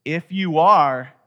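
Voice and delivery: "If you are" drawn out slow and loud; pointed tempter's challenge. Acted. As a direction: stretch "are" high and loud, casting doubt. Stresses how each temptation starts.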